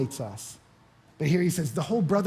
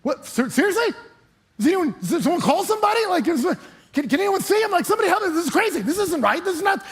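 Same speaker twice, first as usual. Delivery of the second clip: high, loud, frantic panic; a churchgoer who just heard a lion is hunting him; mock terror, voice shooting up; panicked; 12 semitones higher, 5 dB louder than usual. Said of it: "you'd be at the door like".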